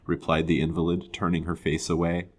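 ordinary delivery, nothing marked

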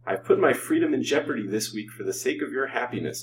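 usual speaking voice

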